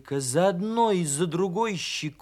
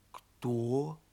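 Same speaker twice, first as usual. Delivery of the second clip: wary query; 3 semitones lower, 6 dB quieter than usual. A narrator's reading. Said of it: "переспросил медвежонок".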